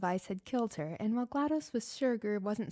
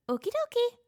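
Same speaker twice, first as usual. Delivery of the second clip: very high, louder squeaky robot voice; cheerful robot; 14 semitones higher, 6 dB louder than usual. Acted. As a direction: chirp it happily like a little robot.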